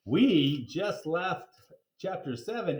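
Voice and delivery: usual speaking voice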